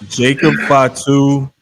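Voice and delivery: ordinary delivery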